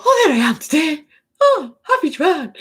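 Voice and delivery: high, delighted cry to the mirror; acting out a happy greeting to oneself; joyful self-greeting. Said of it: "living in my joy".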